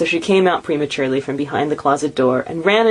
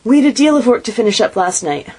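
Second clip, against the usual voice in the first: higher, explaining herself; lightly voiced girl; 7 semitones higher, 4 dB louder than usual. A narrator's reading. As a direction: answer mother apologetically. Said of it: "replied the girl".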